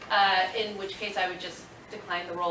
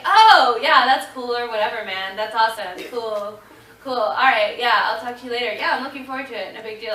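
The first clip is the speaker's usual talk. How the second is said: faked nonchalance; 3 semitones higher, 10 dB louder than usual. Playing it cool on the phone.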